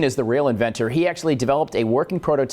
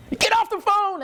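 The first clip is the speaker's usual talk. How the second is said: mock neighbor lady. Neighbor on party line.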